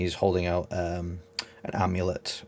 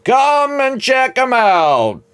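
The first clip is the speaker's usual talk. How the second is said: high, loud, slow barker's pitch; market hawker call; 13 semitones higher, 11 dB louder, slower than usual. Acted. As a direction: sell it like a fairground barker. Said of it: "someone going".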